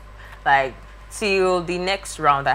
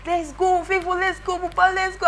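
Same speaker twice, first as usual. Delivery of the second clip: mock rallying call. Retold urging group on.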